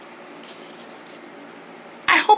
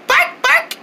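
dog bark; 8 semitones higher than usual. Shown as a demonstration. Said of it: "Let's say bark noises like a dog".

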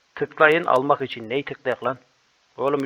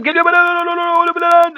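screaming; 13 semitones higher, 4 dB louder than usual. Real, not acted. Screaming down slide.

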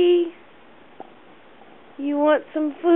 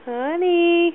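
normal talking voice

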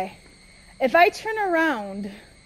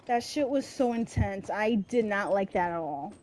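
drained relief; 5 semitones lower, 7 dB quieter than usual. Real, not acted. Winding down after intense fight.

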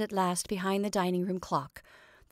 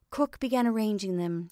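plain reading voice

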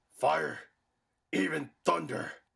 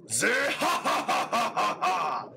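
booming gleeful villain cackle; villain laugh; 5 semitones higher than usual. Acted. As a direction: laugh out your triumph, high and proud. Villain gloats over his power.

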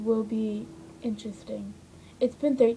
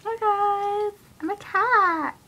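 cute cat voice; 10 semitones higher, 4 dB louder, much slower than usual. Pretend. Pretending to be a cat.

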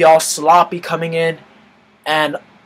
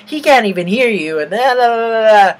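lightly mocked girl's jeer, pitched up; higher, mocking retold taunt; imitating rude girl; 5 semitones higher, 4 dB louder, faster than usual. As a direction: mimic her scornful chatter trailing into da-da-das. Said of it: "she's like".

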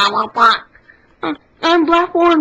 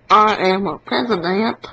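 low, solemn president; 9 semitones lower than usual. Pretend. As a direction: announce it deep and grand. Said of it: "One hundred impressions".

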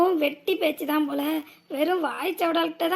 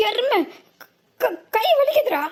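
pained cry; 7 semitones higher, slower than usual. Acted. Arm twisted, crying in pain.

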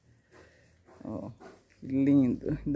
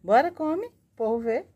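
squeaky pet talk; 6 semitones higher than usual. Real, not acted. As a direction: sweet-talk the animal into eating. Coaxing the tortoise to eat.

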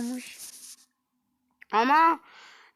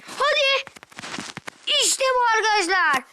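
excited cheer; 9 semitones higher than usual. Real, not acted. Cheering to viewers after a good play. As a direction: cheer it like you just won.

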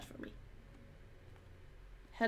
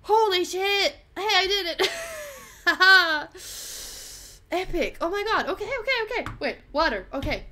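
high, loud elated shouts; giddy with adrenaline; adrenaline shakes; 7 semitones higher, 6 dB louder than usual. Real, not acted. Triumphant after escaping.

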